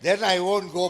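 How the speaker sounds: loud and high through a laugh; old man's laughing comeback to the guru; laughing loud retort. Retold or imitated. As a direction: fire back with a stubborn laugh.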